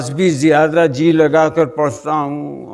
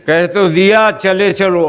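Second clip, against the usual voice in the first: louder, higher impatient retort; exasperated repeat; 4 semitones higher, 3 dB louder than usual. Acted. God rebukes the nagging worshipper, loudly impatient. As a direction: push it out as if already said once.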